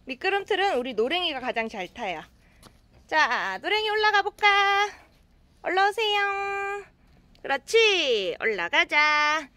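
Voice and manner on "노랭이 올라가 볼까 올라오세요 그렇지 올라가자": coaxing pet talk. Real, not acted. Coaxing cat onto slide.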